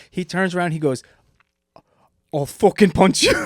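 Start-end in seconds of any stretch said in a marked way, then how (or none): 2.3-3.3 loud, high mock Irish threat; thick Irish accent. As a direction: imitate an angry friend in thick Irish, laughing. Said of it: "In the thickest Irish accent".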